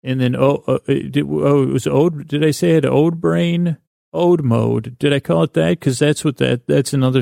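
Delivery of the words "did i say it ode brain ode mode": ordinary delivery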